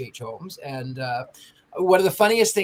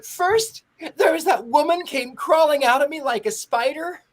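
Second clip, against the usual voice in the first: much higher, louder tearful recounting; shaky contestant voice; 11 semitones higher, 5 dB louder than usual. Retold or imitated. Contestant telling the killer actor what scared her, tearful voice. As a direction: speak as a frightened woman near tears. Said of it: "And she says".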